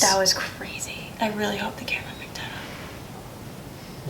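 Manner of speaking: awed hushed whisper. Real, not acted. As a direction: whisper in disbelief. After hearing a knock.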